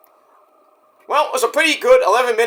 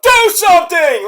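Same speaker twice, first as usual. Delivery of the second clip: loud, much higher yelling; a high, loud, desperate yell at the ref; shouting at the ref; 12 semitones higher, 6 dB louder, slower than usual. Retold or imitated. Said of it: "is like".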